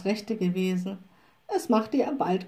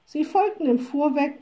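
plain reading voice

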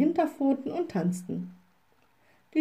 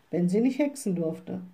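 ordinary delivery

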